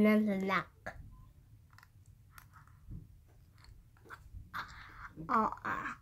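pushing retainer in with fingers; talking with mouth stretched open. Real, not acted. Seen 0: Fingers in mouth with tongue out, pushing appliance in.

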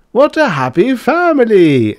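very high, loud jest; wag's exclamation. A narrator's reading. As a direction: call it out as the joker in the crowd. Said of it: "exclaimed some wag".

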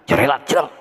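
usual speaking voice

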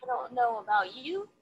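ordinary delivery

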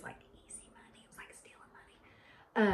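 conspiratorial whisper to the viewers; hushed confession about easy money; whispered aside. Real, not acted. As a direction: whisper it like a secret.

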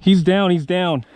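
loud, slow, urgent report; urgent callout. Real, not acted. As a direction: call it out over gunfire. Callout in firefight.

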